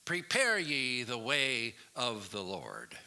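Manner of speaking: loud, high, slow booming proclamation; drawn-out grandiose radio announcer; very important announcer. Acted. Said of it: "a very important announcer saying".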